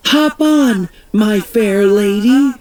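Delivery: put-on light plucky girl voice; Nelly. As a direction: cheerfully offer a ride. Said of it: "Nelly told the Queen".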